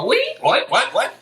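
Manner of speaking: high, rising "what?" like curious dog ears lifting; playful dog-ears impression; perked-up cocker spaniel. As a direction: pitch it way up, quick questioning yips. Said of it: "when you whistle at a cocker spaniel and their ears go".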